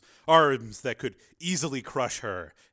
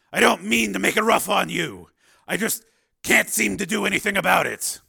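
loud, raised, apologetic character voice; troubled Steele; 8 semitones higher, 9 dB louder than usual. A narrator's reading. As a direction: push out the apology high and loud.

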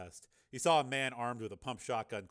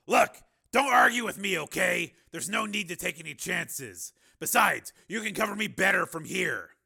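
raised, insistent character voice; Steele; 7 semitones higher, 10 dB louder than usual. Acted. Steele talking Raven out of coming along, loud and insistent. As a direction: argue it loudly, pitched up.

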